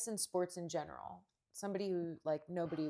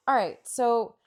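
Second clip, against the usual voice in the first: ordinary talk, nothing marked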